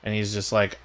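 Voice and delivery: ordinary talk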